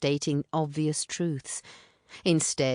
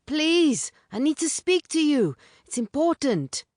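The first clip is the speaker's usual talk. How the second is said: higher, louder urgent plea; pleading call; 12 semitones higher, 4 dB louder than usual. A narrator's reading. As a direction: beg her to come out, calling. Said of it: "He raised his voice again".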